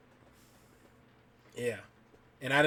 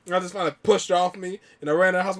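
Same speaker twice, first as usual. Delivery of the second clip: ordinary talk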